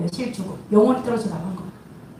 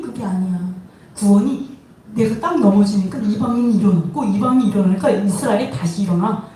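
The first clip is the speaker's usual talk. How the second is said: ordinary delivery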